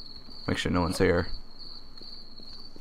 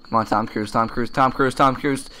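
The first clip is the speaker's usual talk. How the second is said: ordinary talk, nothing marked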